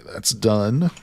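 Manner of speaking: normal talking voice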